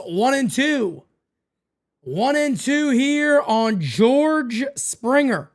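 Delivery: play-by-play call. Acted. Streamer calling each pitch for chat, slow broadcaster style. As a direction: build the pitch like a radio booth call.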